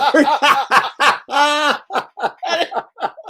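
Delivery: long, high-pitched laughing fit. Real, not acted. Panelist cracking up at the phone store prank, long high laugh. Laughing at his own joke.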